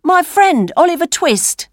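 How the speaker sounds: high, proud introduction; street boy. A narrator's reading. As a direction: present your pal in a high lad's voice. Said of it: "the Dodger said to the old man".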